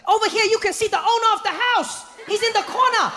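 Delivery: cheery guide. Retold or imitated. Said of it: "he's like".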